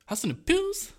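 squeaky high voice. Retold or imitated. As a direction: pitch it way up and squeaky. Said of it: "Oh, sorry".

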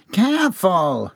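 high, drawn-out whine; whining. A narrator's reading. Employee grabbed by the arm, whining. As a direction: stretch it into a petulant complaint. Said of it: "John whined".

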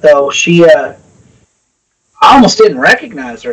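ordinary delivery

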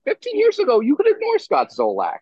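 rising into an outraged rant; heated rant. Real, not acted.

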